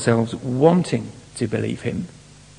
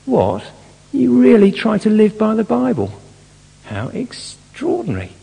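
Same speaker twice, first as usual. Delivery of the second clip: high, louder disbelieving scoff; mock incredulous; 10 semitones higher, 4 dB louder than usual. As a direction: sound amazed anyone could be so odd. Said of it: "the attitude surface".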